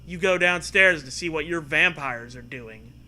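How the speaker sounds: ordinary talk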